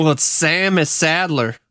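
slow, high Southern drawl; drawn-out comic Southern accent. Pretend. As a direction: introduce him with a put-on Southern twang. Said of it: "you get a Southern accent".

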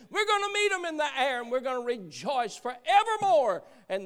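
high, loud burst of rejoicing; worked-up preacher at the climax; exultant preaching. Real, not acted. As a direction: let the voice soar in triumph. Preacher's climax about rejoicing in heaven.

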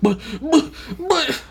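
high, stammered mock protest; imitated flustered stammer; flustered sputtering. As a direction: sputter helplessly as if lost for words.

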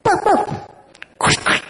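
tiny high-pitched imitated yip; little dog's bark. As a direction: yap like a Chihuahua at the door. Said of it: "trotted right up to the door and went".

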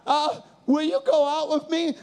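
hammed-up nerves, voice out of control; nervous possessed voice. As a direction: blurt it out as a terrified teenage boy. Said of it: "I sound like I am possessed".

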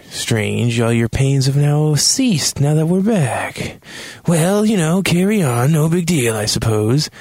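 high, drawn-out put-on voice; mock casual suspicion. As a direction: lift the pitch in exaggerated nonchalance. Acts David's sarcastic remarks.

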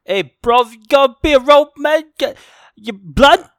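over-the-top fake London accent; mock London slang. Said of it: "that was an Australian accent".

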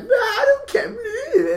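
very high, drawn-out caricature; mock dismissive teacher. Classmate imitates teacher brushing off repayment, mock dismissive. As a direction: exaggerate the don't-care in a loud squeal. Voices teacher in retold exchange.

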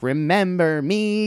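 put-on slow, high, drawn-out drunk singsong; wasted heckler. Wasted lady greets comic from bar, high drawn-out call. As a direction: imitate a drunk woman calling out, words stretched. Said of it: "and she's wasted".

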